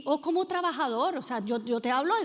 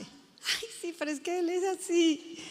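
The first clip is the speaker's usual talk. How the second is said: mock permissive mom; 5 semitones higher than usual. Permissive mother excusing child.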